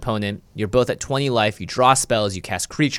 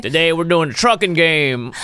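host impression; 8 semitones higher, 4 dB louder than usual. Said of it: "His American twin".

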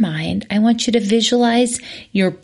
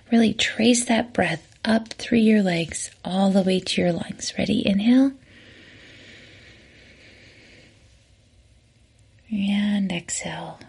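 soft, whispered breathing cues with long pauses; 5 dB quieter than usual. Acted. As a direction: guide each inhale and exhale gently. Leads a breathing exercise.

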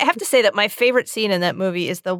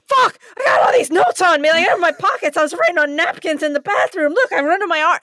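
high, loud panicked rush of words; panicked outburst; 10 semitones higher, 4 dB louder than usual. Retold or imitated. Frantic informant dumping his notes on Woodward, high loud panic.

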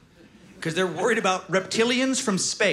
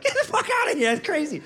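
incredulous commenter; 10 semitones higher than usual. Retold or imitated. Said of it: "stuff like".

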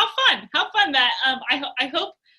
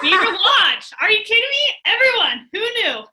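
excited; 8 semitones higher, 5 dB louder than usual. Real, not acted. Delighted at town name.

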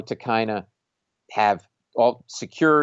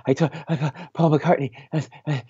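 higher, gasping, broken-off words; mock hyperventilating; 4 semitones higher than usual. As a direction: act out panting too hard to finish. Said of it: "I'm, like, hyperventilating".

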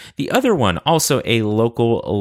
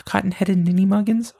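whispered, higher, in mock disbelief; scandalized whisper; 10 semitones higher than usual. Repeating his own silly insult, a scandalized whisper. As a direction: hush it like a shocking word.

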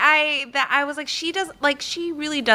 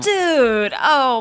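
higher, louder groaning dismay; dismayed reaction; 4 semitones higher, 5 dB louder than usual. Retold or imitated. Viewer reacting to "let it roll", dismayed reaction. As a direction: replay your exasperation, raised and loud. Retold exasperated reaction to his choice.

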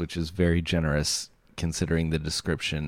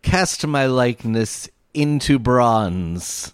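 put-on grand, slow old-timey poet voice; hammy stage actor; 6 semitones higher, 5 dB louder, slower than usual. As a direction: declaim like a hammy Shakespearean actor. Voicing a pompous old poet.

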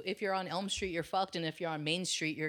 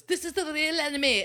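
dramatic quote; 11 semitones higher, 6 dB louder than usual. Retold or imitated. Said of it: "and then goes".

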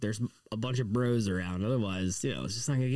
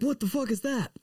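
high-pitched disbelieving shriek; shocked high squeal; 12 semitones higher than usual. Retold or imitated. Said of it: "they're like".

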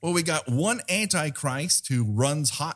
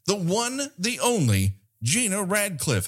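announcer intro; 8 semitones higher than usual. Acted. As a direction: build up the name. Hosts introducing co-host.

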